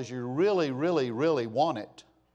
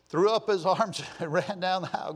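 ordinary talk

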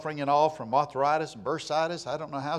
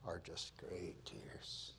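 hushed whisper; 8 semitones lower, 18 dB quieter, much slower than usual. Real, not acted. Tender moment, brother crying.

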